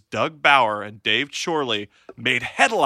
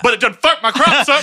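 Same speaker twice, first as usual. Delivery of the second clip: loud, high mock-outraged country farmer; mad farmer; 9 semitones higher, 8 dB louder than usual. Farmer ranting to wife about crops, mock fury. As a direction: erupt like a hammed-up furious farmer. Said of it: "how mad can you be as a farmer?".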